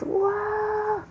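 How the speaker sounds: high mock gasp of wonder; a crowd's amazed wow; awed wow. Congregation amazed by Jim's words, high awed wow.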